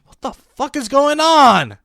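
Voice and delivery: loud, high, drawn-out yell; exasperated shout; baffled outburst. Real, not acted. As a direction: burst out in total bewilderment. Confusion boils over into outburst.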